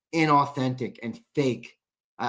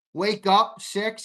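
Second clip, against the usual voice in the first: normal talking voice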